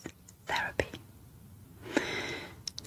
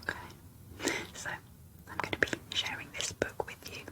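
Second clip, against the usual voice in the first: asmr whisper. Real, not acted. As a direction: whisper every word close and gentle. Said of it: "in an A S M R whisper".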